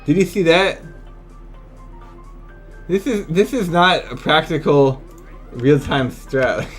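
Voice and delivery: normal talking voice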